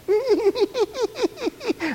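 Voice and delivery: high-pitched imitation child giggle; little kid's giggle. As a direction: titter on and on like a tickled little kid. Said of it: "the little kid laughs. They go".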